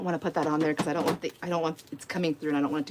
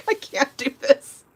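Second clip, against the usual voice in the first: much higher, laughing through the words; laughing hard; 4 dB louder, much slower than usual. Real, not acted. Telling the cat story, cracking up for real. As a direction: barely get it out for laughing. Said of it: "I'm laughing so hard".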